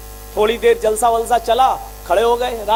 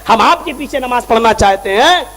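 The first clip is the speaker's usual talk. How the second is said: preaching; 3 semitones higher, 5 dB louder than usual. Acted. Preacher addressing his brothers, rising preaching. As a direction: plead with the crowd.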